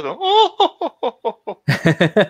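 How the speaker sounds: high haughty laugh behind hand; smug laugh. Pretend. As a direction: cackle like a smug rich girl. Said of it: "Yeah, with the, the hand over my mouth".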